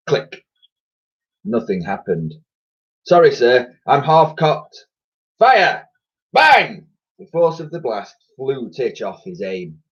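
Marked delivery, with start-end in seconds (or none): 3.1-4.9 nervous soldier. A narrator's reading. Titch apologising. 5.4-5.9 loud high fire order; shouted order. A narrator's reading. Admiral orders again. 6.3-6.9 loud bang. A narrator's reading. Narrated gunshot.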